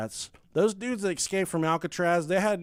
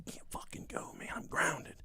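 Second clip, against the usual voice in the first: whispered; 12 dB quieter than usual. Retold or imitated. Grounded son whispers to friend.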